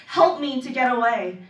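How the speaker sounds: loud dramatic cry for help; shouted plea. Acted. Poet shouting to the clouds, loud pleading cry. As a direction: call it out to the skies, full voice. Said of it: "I shout to the clouds".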